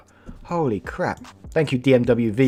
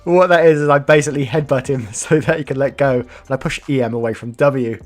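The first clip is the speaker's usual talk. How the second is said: loud, high retake with laughter creeping in; raised voice, tickled and struggling on; fighting back laughter; 5 semitones higher, 7 dB louder than usual. Real, not acted. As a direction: push through the line while laughing. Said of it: "Sorry to laugh at that".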